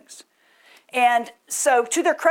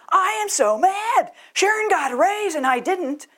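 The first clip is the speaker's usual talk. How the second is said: irate worker; 11 semitones higher, 4 dB louder than usual. Retold or imitated. Irate worker yelling at the manager about a raise, hammed-up high shout. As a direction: storm in as a worker who got passed over. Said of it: "somebody comes in and says".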